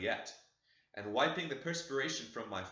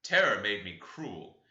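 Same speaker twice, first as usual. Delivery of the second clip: usual speaking voice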